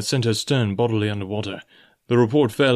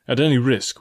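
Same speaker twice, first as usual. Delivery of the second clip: ordinary delivery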